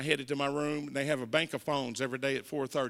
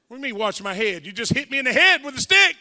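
loud, higher indignant outburst; outraged protest; 7 semitones higher, 12 dB louder, faster than usual. Retold or imitated. Handcuffed man protesting to the cop, loud and outraged. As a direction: yell the injustice at the cop.

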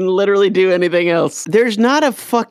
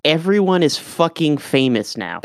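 ordinary delivery

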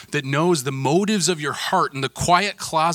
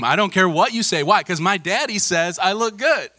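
loud, higher-pitched mock bravado; defiant little boy; 6 semitones higher, 5 dB louder than usual. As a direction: crow it like a proud kid. Son defiantly answers sisters, acted out.

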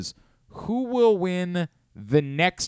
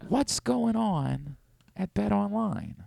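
soft, slow impression, pitched high; Brian Windhorst; 7 semitones higher, 5 dB quieter, slower than usual. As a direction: drag it out softly, high and mysterious. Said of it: "it's soft for sure".

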